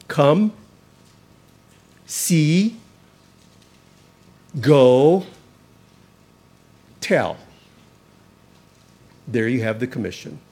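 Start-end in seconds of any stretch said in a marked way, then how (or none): 0.1-7.4 each word set apart and stressed: "Come", "see", "go", "tell"; four words stressed. Shown as a demonstration. Preacher stressing four key words for the congregation, spaced and emphatic. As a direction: punch "Come", "see", "go", "tell" one by one with pauses. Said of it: "make note of four words here".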